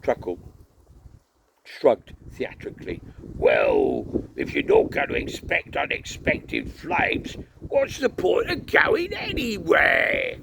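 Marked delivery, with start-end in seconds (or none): 3.4-10.4 high, slow mock-indignant barbarian; theatrical shrug. Truckle shrugging theatrically at Cohen, high mock indignation.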